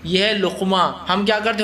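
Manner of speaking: normal talking voice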